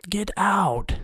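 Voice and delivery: whispered, incredulous; scornful whisper. Real, not acted. Mocking the fake Pear phone in a whisper. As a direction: hiss it in disbelief.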